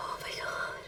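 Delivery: soft, unvoiced gasp of words; overwhelmed whisper. Real, not acted. Viewer floored by a sad scene, overwhelmed whisper. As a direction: whisper it as if undone.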